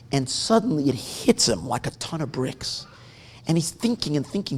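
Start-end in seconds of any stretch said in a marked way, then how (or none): none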